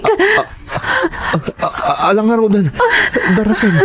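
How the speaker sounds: high frantic urging, tears breaking on the last word; panicked urging. Acted. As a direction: push them to hurry, scared for the child. Father urges rush to hospital. (nadanagan a panangpadaras, agsangsangit)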